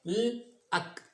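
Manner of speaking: ल then अक, split apart; sounded out. Shown as a demonstration. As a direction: break लक into ल and अक. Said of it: "यू का sound क्या बन रहा था".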